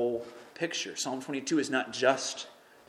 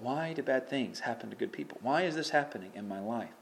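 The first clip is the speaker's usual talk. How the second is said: usual speaking voice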